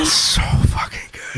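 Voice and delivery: breathless high whisper, slow. Real, not acted. Whispering to friends how good the movie is, slow and breathless. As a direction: hush it like a secret you can't contain. Gushing in a hushed aside.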